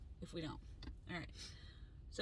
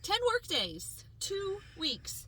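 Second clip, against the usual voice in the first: outraged emphasis; 8 semitones higher, 10 dB louder, slower than usual. Real, not acted. Correcting friend, reliving outrage.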